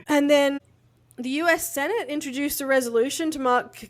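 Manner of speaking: usual speaking voice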